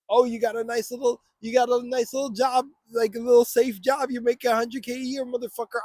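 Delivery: Satan tempting. Retold or imitated. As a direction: coax like a tempter. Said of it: "That's what Satan was tempting Christ with".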